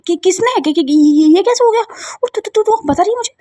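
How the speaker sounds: shocked stammer. Acted. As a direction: stutter in disbelief, rising. Shocked by the news.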